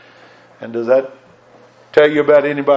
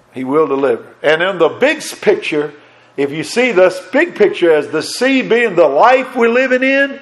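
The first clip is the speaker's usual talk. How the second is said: higher-pitched, insistent pulpit delivery; fervent preaching; 5 semitones higher than usual. Real, not acted. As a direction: preach it with rising fervour and assurance. Preacher builds to fervent promise.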